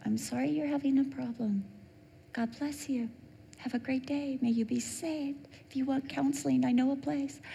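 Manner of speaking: soft, high, sweet brush-off; sugary soft politeness. Retold or imitated. Preacher sweetly answering a stranger yelling at her. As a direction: kill them with soft kindness.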